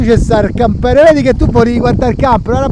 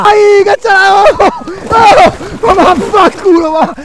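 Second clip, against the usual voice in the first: explosive italian cursing; 8 semitones higher, slower than usual. Real, not acted. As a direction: curse him out, voice up high. Cursing at kayak partner.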